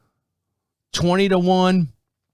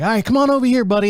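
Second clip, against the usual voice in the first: higher, louder cheerful nurse; chirpy nurse; 8 semitones higher, 4 dB louder, faster than usual. Retold or imitated. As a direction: wave him over brightly. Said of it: "she's like".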